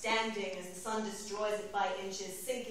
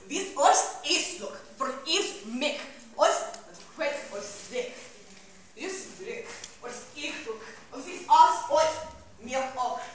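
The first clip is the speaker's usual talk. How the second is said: higher, emphatic bursts of strange speech; invented-tongue declamation; 4 semitones higher, 6 dB louder than usual. Acted. Rose Lalonde asserting her nature in a strange tongue, declaimed. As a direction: punch out the alien phrases.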